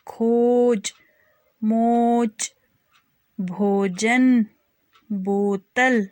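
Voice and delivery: each word said slowly and clearly, with a pause after it; word list drill. Shown as a demonstration. Teacher reading practice words for children to repeat, slow and clear. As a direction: model every word for the class to copy.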